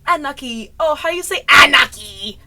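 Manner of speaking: loud, high theatrical quote; Lafayette. Acted. Vlogger quoting a Hamilton scene, hammed-up Lafayette. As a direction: blurt it out, booming on the last word. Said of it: "where Lafayette is like".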